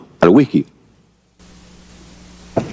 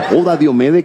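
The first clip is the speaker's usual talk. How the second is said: ordinary talk, nothing marked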